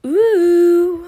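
loud delighted ooh; excited squeal. Real, not acted. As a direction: burst out with delight. Sudden delight at the fort.

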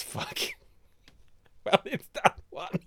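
high, squeaky laughing words; cracking up mid-sentence; real laughing. Real, not acted. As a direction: barely get it out through giggles. Cracks up over hint about university.

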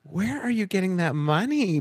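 incredulous repeat. Retold or imitated. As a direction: ask it again, still baffled. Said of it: "repeat of quote".

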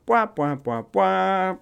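mock sad-trombone wah wah, softly sung; comic fail sound effect. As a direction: imitate a deflating horn sound effect. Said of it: "something fancy should be popping up".

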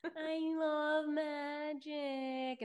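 very slow, high, drawn-out I love magic; drawn-out dreamy. Pretend. Echoing her cohost dreamily, very slow. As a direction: stretch every word like a spell.

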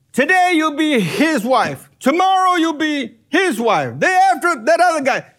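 mock the absurd scenario; lightly hammed-up scoffing; mocking ridicule. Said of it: "isn't it gonna be ridiculous".

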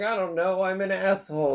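high, upset I don't know; agitated confession. Retold or imitated. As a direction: blurt it out, ashamed. That's what happened first.

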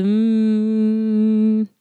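ordinary talk, nothing marked

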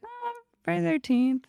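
high-pitched mock version of a friend; mocking imitation. Teasing co-host about their earlier opinion, mocking imitation. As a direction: mimic the friend in a put-on high voice. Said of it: "before you're like".

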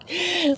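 short high giggle. Real, not acted. Another burst of laughter.

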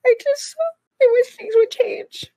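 high, tearful, worn-out plea; crying from sheer exhaustion; real crying. Real, not acted. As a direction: talk through tears, voice pitched right up. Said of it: "'Cause I'm crying".

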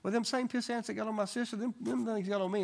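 playing the small boy's plea; little boy. Pastor retells a little boy asking prayer for ant bites. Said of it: "He said".

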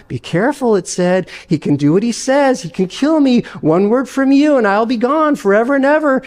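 chattering lizard. Acted. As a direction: coax the ghost like a sly pet. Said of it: "the lizard began chattering to the ghost".

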